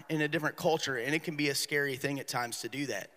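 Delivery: ordinary talk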